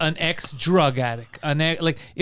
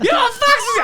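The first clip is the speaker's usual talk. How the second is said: much higher, louder, cracking with laughter; laughing disbelief; 6 dB louder, faster than usual. Retold or imitated. Asking Adam about his fax machine, squealing with laughter. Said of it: "I'm just losing my shit".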